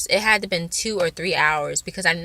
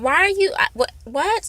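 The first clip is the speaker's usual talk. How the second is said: flustered surprise; 10 semitones higher than usual. Retold or imitated. Confronted by stalker, flustered.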